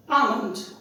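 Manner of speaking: almond said "AH-mund"; almond with silent L. Shown as a demonstration. Teacher modelling the word almond, silent l. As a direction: drop the l in almond, long ah. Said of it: "L is silent".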